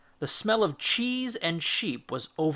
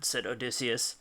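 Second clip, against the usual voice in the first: ordinary delivery, nothing marked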